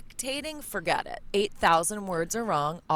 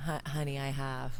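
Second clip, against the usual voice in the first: Southern drawl; 7 semitones lower, 6 dB quieter than usual. Shown as a demonstration. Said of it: "I can give you that real drawl".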